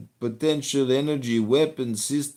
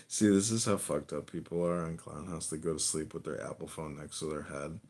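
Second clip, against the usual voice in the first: ordinary talk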